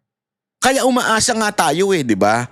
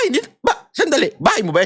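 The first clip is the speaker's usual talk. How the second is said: puzzled question; 7 semitones higher, faster than usual. Retold or imitated. Acted bystander exchange.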